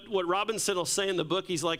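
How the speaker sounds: usual speaking voice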